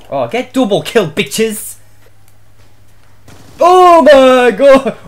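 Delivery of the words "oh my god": stunned slow shout. Real, not acted. Gamer shocked by play.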